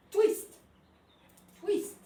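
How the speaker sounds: high sharp command to a dog; loud command. Real, not acted. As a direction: call the trick out loud.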